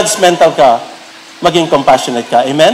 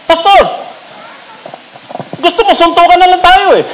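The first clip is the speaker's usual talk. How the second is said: mock angry deacon; 11 semitones higher than usual.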